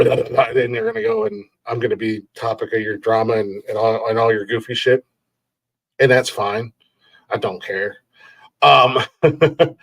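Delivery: usual speaking voice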